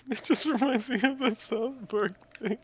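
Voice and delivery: squeaky high falsetto parroting; mocking mimicry. Friend mimics the streamer's remark back, high mocking voice.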